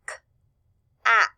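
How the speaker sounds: "K-at" split into k, short a, t; sounded out. Shown as a demonstration. Teacher asking class to listen, sounded out. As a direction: say "K-at" sound by sound, long gaps between. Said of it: "Let's listen".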